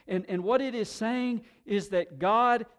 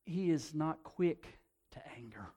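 whisper; 3 semitones lower, 6 dB quieter than usual. Real, not acted. Quiet aside on God's patience.

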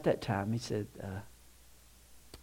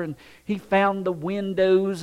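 ordinary talk

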